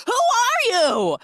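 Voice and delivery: high, loud, drawn-out mock scream; shocked shriek. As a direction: act out the outraged discovery at full pitch. Acting girlfriend finding a stranger.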